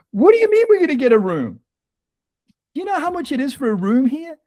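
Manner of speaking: high, fast incredulous objection; can't believe what she's proposing; retold pushback against his wife's plan; incredulous protest. Retold argument about getting room.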